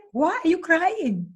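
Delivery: slow, high puzzled asking; retelling asking her crying secretary why; puzzled question. Retold or imitated. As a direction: ask it slowly in disbelief. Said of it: "I mean, when I said".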